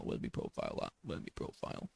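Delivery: whispered to himself; breathy half-whisper while searching the app; under-breath mutter. Real, not acted. Creator whispering to himself as he scrolls TikTok, hushed.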